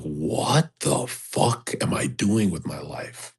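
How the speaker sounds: slow, low, hushed disbelief; low, soft, dejected self-questioning; retold exasperated despair. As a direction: draw it out quietly, questioning your whole existence. Retold despair at silly comment.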